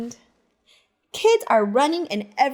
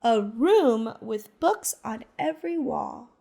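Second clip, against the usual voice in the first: ordinary delivery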